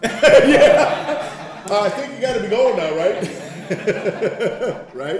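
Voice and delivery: loud laughing, fast mock dismissal. Real, not acted. Joking about the family's reply, laughing loudly. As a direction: laugh through the brush-off.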